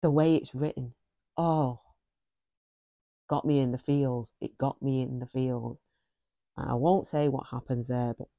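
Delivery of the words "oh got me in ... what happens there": ordinary delivery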